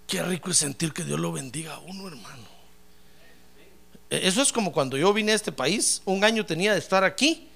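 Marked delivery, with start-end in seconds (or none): 0.1-2.5 soft. Real, not acted. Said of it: "repeats line quietly".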